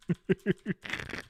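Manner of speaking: quiet wheezing chuckle; soft, breathy laugh to yourself. Real, not acted. Streamer amused by own movie idea, chuckling.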